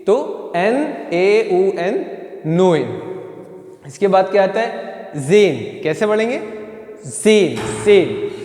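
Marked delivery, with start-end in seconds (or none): 2.4-3.0 नोइन with the "oy" glide; German pronunciation. Shown as a demonstration. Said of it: "ओ ए करके आवाज़ आएगी". 7.1-8.3 ज़ेन, सेन as "tsen" with long "e"; German pronunciation. Shown as a demonstration. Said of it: "सेन करके उसकी आवाज़ आएगी".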